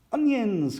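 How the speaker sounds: high, excited exclamation; high-pitched storybook delight; excited cry. A narrator's reading. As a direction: cry out as if just remembering. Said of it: "cried Pepper".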